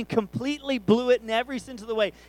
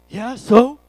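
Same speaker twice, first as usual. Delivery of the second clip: loud, higher defiant comeback; imitated angry teen; 4 semitones higher, 14 dB louder than usual. Son challenged about trouble at school, imitated angry comeback. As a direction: throw it back like a defiant teen. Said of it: "he's all angry".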